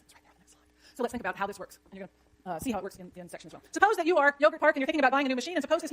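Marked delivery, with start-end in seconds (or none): 0.0-1.0 whispered aside. Real, not acted. Exasperated professor whispering to himself about the slides. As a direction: breathe it under your breath.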